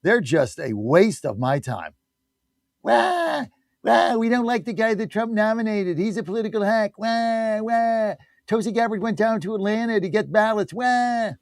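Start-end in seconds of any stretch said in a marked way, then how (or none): 2.8-11.3 whiny crybaby. Pretend. Mocking Democrats as whiny crybabies.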